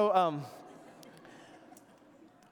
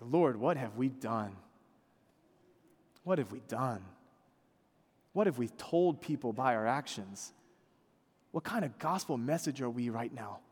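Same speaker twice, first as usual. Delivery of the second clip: anguished prayer; 6 semitones lower than usual. Retold or imitated. Preacher voicing his own prayer of regret, low and grieving.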